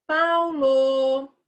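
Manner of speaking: loud drawn-out call across the room; calling out a name. Shown as a demonstration. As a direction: call PAULO, holding the vowels long. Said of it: "igual você chama a pessoa, você fala".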